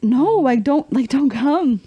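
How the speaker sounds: high, drawn-out mock plea; retold whiny begging off; pleading protest. Daughter begging dad not to come, pleading.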